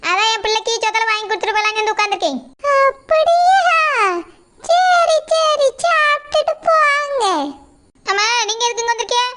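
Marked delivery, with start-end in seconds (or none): 2.7-7.2 put-on high, slow old woman's voice, prying and nosy; old mother-in-law. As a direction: drag out each word like a curious granny.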